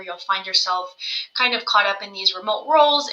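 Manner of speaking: ordinary delivery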